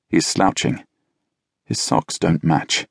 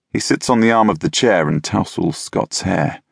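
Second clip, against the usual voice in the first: usual speaking voice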